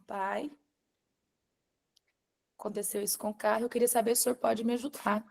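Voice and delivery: very soft, meek asking; subdued request. Retold or imitated. Daughter asking father for help with the car, quiet and reluctant.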